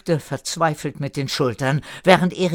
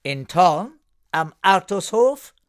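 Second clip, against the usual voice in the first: higher voice rising at the end; rhetorical question; 7 semitones higher, 5 dB louder than usual. A narrator's reading. Erika questions where the post office is, rhetorically. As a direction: ask it as if the answer were obvious. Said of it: "fragte Erika rhetorisch".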